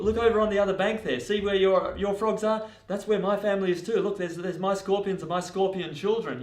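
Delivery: high, insistent, hurried begging; pleading protest. Acted. Scorpion swearing to the frog he won't sting, high urgent pleading. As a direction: plead urgently to win trust.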